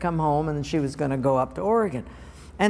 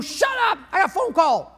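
mock yell; 14 semitones higher, 3 dB louder than usual. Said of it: "phone ring".

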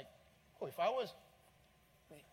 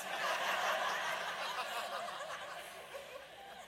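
high laugh. Real, not acted. Laughs at own joke.

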